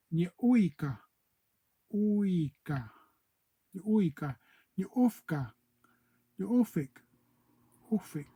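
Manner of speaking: a teacher modelling O'odham words for sister; careful O'odham demo. Shown as a demonstration. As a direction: spell out "uika", "ufka" and "ufik" as a teacher, one word at a time. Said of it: "and you say".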